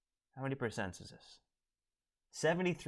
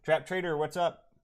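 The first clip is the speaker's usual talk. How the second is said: higher, louder hyped greeting; RT voice practice; 5 semitones higher, 7 dB louder than usual. Retold or imitated. Practicing RT's greeting, higher and louder. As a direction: call it out like RT hyping the room. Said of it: "I gotta practice my R T voice".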